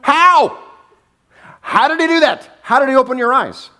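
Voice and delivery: high-pitched mock-outraged questioning; acting out flustered, exasperated interrogators; incredulous demand. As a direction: demand answers, voice shooting up. Flustered Pharisees interrogate the man.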